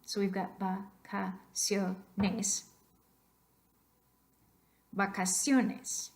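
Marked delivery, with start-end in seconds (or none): 0.6-2.6 vacaciones broken slowly into va, ca, cio, nes; slow, separated va-ca-cio-nes; syllable by syllable. Shown as a demonstration. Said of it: "voice stress should go onto the second last syllable". 4.9-6.1 vacaciones said slowly with extra weight on "cio"; slow teaching demo, vacaCIOnes; stress on second-last syllable. Shown as a demonstration. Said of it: "Where do you hear that extra".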